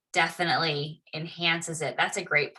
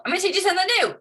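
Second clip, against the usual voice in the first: excited; 10 semitones higher, 3 dB louder, much faster than usual. Real, not acted. Instructor announcing a new tool to the class, excitedly. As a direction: pitch jumps much higher, bright teacherly enthusiasm.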